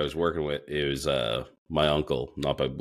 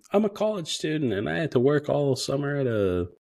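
put-on high whiny college girl voice; mock sob story; 9 semitones higher than usual. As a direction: pitch it way up and whine like a pouting student. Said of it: "Don't give me the sob story".

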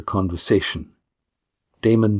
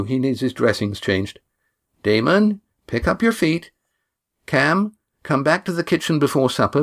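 higher, brisk ordering voice; bossy young woman; 4 semitones higher than usual. Acted. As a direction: hustle them along briskly. Kat giving brisk orders.

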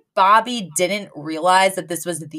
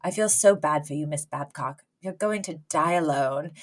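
soft pitying jab; 4 semitones lower, 9 dB quieter than usual. Retold or imitated. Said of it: "he looks at Cece and he goes".

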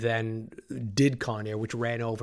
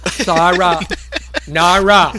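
higher, much louder hammed-up farewell; loud mock Malkovich line; 5 semitones higher, 12 dB louder, much slower than usual. Malkovich's theatrical farewell retold, loud and higher. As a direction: belt out the goodbye like a ham actor. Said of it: "It's like".

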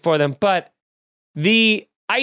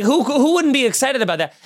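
ordinary talk

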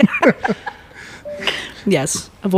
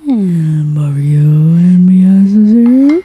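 whisper; 7 dB louder, much slower than usual. Acted. Whispered podcast intro.

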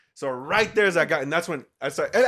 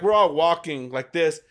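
plain reading voice

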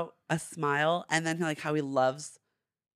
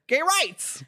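high-pitched mock proclamation; imitated diva cheer, pitched up; Christine Baranski; 10 semitones higher than usual. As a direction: proclaim it high, breaking into laughter. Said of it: "Christine Baranski said".

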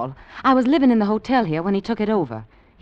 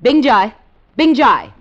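calling out; 5 semitones higher, 9 dB louder than usual. Acted. Calling the guard in.

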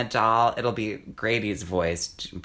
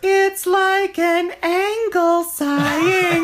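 very high, loud, slow breathy diva voice; Dina Martina voice; 8 dB louder, much slower than usual. Retold or imitated. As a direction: coo it like a campy drag diva. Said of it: "And then she said".

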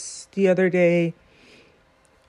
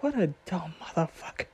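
muttered thought; 2 semitones lower, 11 dB quieter, faster than usual. Retold or imitated. Judge privately thinking about the lawyer, a soft mutter. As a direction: mutter it under your breath as her secret thought. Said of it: "in her mind, she was like".

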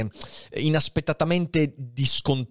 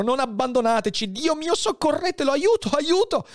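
much higher, rising panicked call; desperate cry for help. Acted. Edmond begs for help over dying Faria, panicked.

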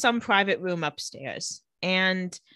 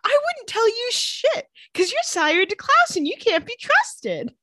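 acted-out indignant outburst, much higher; indignant retort; 13 semitones higher, 4 dB louder than usual. Retold angry argument with Tyler.

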